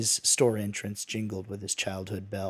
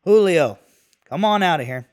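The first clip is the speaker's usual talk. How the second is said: loud, high call to another room; shouted; 7 semitones higher, 10 dB louder than usual. A narrator's reading. Johnny calls his helper from the back room, shouting. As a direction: holler for someone to come out. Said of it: "and shouted".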